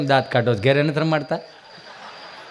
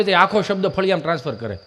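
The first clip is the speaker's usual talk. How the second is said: usual speaking voice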